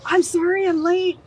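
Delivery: high, loud breathless apology for being late; rushed, loud, high apology; flustered apology. Retold or imitated. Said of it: "I'm like".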